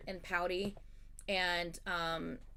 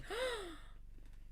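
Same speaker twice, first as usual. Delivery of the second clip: high hushed breathy gasp; offended gasp; 13 semitones higher, 8 dB quieter than usual. Retold or imitated. Reacting to Allison's finders keepers, offended gasp. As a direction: gasp as if insulted.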